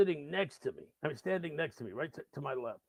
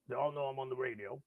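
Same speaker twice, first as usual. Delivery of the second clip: ordinary talk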